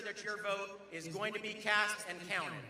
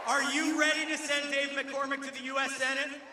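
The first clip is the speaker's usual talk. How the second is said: rising, louder crowd call; rally call and response; 4 semitones higher, 6 dB louder than usual. Real, not acted. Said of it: "a little call and response".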